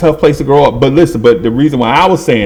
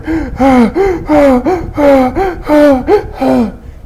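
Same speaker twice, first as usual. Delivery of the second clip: a long high wheezing gasp; 12 semitones higher than usual. Retold or imitated. Winded boy answering the coach with a long gasp. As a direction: gasp for breath, drawn out and high.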